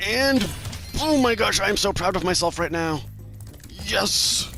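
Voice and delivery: excited. Real, not acted. Nails the flawless run.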